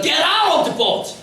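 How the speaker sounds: very slow, drawn-out English title; preacher announcing a famous sermon title. Shown as a demonstration. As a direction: spell out the title word by word. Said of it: "설교 제목이 뭐냐니까".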